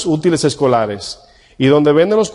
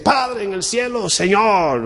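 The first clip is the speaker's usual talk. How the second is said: higher, drawn-out mock prayer; put-on prayer voice; 7 semitones higher, slower than usual. Shown as a demonstration. Preacher mimicking showy prayers to God, put-on voice. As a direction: stretch it out in a fake pious voice. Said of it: "No hay que cambiar la voz".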